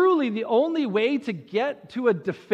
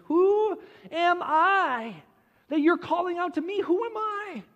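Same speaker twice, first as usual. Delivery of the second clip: incredulous questioning; 9 semitones higher than usual. Acted. Moses asking God why he is called, incredulous. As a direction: stretch the question high in disbelief. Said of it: "Moses then responds to God by asking".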